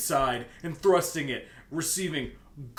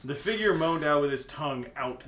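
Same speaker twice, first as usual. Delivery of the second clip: normal talking voice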